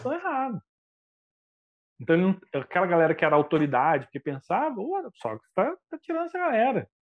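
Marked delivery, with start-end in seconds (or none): 4.8-6.9 higher, puzzled mock voice of the elite; puzzled authorities. Athenian authorities grumbling about Socrates, higher puzzled mock voice. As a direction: pitch up like an indignant insider.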